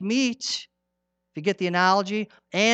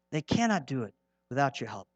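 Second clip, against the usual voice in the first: soft, lower, cannot still leaned on; hushed repeat; 6 semitones lower, 10 dB quieter than usual. Real, not acted. Preacher repeating his plea to the ladies, hushed and low. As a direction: drop the voice to let it sink in.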